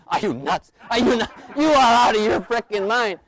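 high, loud mock outrage; building to a comic yell; outraged inner kid. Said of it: "I'm having an argument with myself. My kids".

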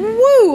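a sharp, high whoop of exasperation; high loud woo. Real, not acted. Wife recalling a rough six months of marriage, exasperated whoop. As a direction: blow out a loud rueful woo.

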